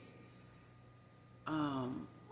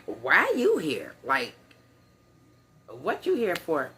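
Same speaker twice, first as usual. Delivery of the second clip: pressing question; 8 dB louder than usual. Retold or imitated. Classmates pressing her again.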